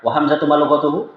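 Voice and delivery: fast wa hamzatu ma lughatuhu run together as one block; wa hamzatu ma lughatuhu rattled off fast without pauses; rushed in one go. Shown as a demonstration. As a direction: blurt it all in one breath. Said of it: "Nggak enak".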